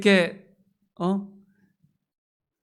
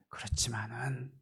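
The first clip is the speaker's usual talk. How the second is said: whisper; 6 semitones lower, 13 dB quieter than usual. Acted. Woman's plea, hushed turn.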